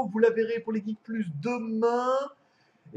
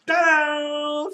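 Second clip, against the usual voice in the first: loud, high drawn-out fanfare; ta-da reveal; 7 semitones higher, 7 dB louder than usual. Real, not acted. Streamer unveils the parcel to viewers, loud triumphant ta-da. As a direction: announce the big reveal with a flourish.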